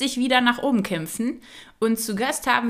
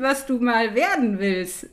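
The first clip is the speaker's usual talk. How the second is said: ordinary talk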